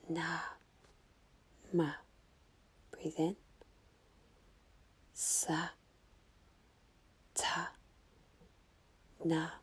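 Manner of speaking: soft whispered chant with quiet breathe-in cues; whispered mantra. Shown as a demonstration. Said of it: "we're going to whisper".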